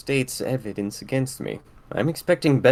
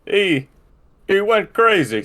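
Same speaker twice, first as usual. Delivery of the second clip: higher, louder, urgent report; agitated explanation; 7 semitones higher, 7 dB louder than usual. Acted. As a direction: blurt it out in alarm. Guard explains attack to warden.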